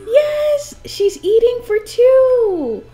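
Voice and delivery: high, loud and thrilled; excited cheer. Real, not acted. Streamer celebrating that her Sim is pregnant, high loud cheer. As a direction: cheer like you just won.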